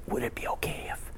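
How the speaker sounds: a soft unvoiced whisper of the question; whispered ask. Quietly asking the other woman by whisper. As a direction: whisper it like a secret request.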